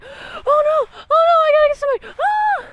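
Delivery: very high, fast, loud hammed-up panic; mock panic. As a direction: shriek in fake horror at the body. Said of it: "She's like".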